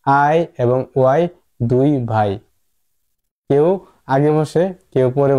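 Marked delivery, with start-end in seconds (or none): 0.1-1.2 slow spelled-out letters. Shown as a demonstration. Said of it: "মনে রাখতে হবে".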